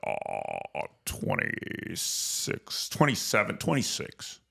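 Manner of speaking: hammed-up evasive stalling over his age; evasive hesitation. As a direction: drag it out as if unsure of your own age. Tom hesitating about his age.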